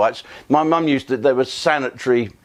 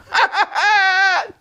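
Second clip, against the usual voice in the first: roaring with laughter at his own joke; shouted laugh. Real, not acted. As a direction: let out a big whooping laugh at the gag. Laughing at the final punchline.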